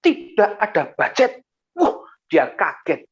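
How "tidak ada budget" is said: firm, raised, emphatic declaration; firm, no joking. Retold or imitated. Pastor answers the manager about church budget, firm. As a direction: answer firmly and mean it. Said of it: "Saya jawab dengan tegas, tidak bercanda".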